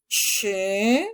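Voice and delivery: a long hushing sh sound; hissing sh. Shown as a demonstration. Teacher demonstrating the sh sound. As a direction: hiss sh like hushing someone. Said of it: "suena como una S silbante".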